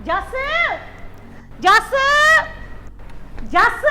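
calling the son's name, rising each time. Acted. Mother calling her son Jass from afar, rising calls.

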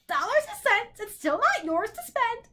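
high, haughty bird voice. A narrator's reading. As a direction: squawk it with disdain. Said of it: "the bird replied haughtily".

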